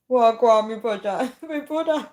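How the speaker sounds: put-on playful Jamaican accent. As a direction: try a Jamaican accent through giggles. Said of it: "I need to say it in a Jamaican accent".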